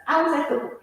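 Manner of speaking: usual speaking voice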